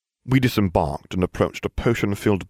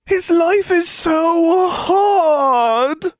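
very high, drawn-out mock wail; whining; 6 dB louder, much slower than usual. A narrator's reading. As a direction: whine tearfully, stretching the words out slowly. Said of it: "Ron whined".

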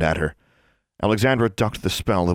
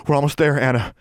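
higher, encouraging reassurance; reassuring; 8 semitones higher than usual. A narrator's reading. Alexandra encourages sick Anna, reassuring. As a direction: urge her on gently.

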